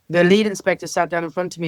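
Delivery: ordinary talk, nothing marked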